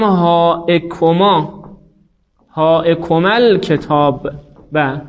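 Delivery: هاکما هاکمل کتاب said very slowly; slowly sounded Arabic example; slow model phrase. Shown as a demonstration.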